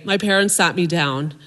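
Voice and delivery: ordinary delivery, nothing marked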